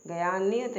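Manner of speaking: ज्ञान said as two syllables, "ga-yaan"; wrong split cluster. Shown as a demonstration. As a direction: slip a vowel between g and y in ज्ञान. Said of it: "ज्ञान नहीं होता".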